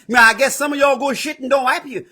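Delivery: ordinary delivery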